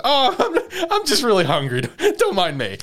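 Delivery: high, laughing mock innocence; flustered excuse. Retelling Shinpei covering up the extra plate, flustered.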